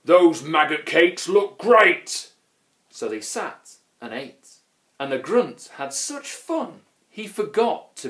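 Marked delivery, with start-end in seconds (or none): 0.1-2.3 loud, slow troll relish; eager troll. A narrator's reading. Grunt spots maggot cakes in food fight, loud and eager. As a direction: savour it loudly, pitch lifted.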